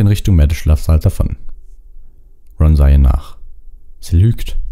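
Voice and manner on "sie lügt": plain reading voice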